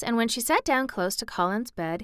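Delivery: ordinary delivery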